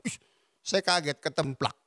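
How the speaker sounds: usual speaking voice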